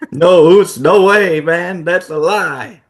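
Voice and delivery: loud, high denial; furious betrayed partner, loud and high; angry Jay. Acted. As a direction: shout the denial at him. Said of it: "he's a little angrier now".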